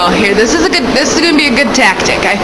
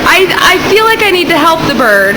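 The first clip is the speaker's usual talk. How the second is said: ordinary delivery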